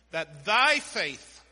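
"thy" hit loud and drawn out; stress on THY. Shown as a demonstration. Preacher shows the congregation whose faith matters, "thy" punched loud. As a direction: lean hard and slow on "thy".

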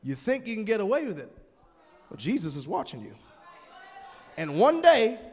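ordinary talk, nothing marked

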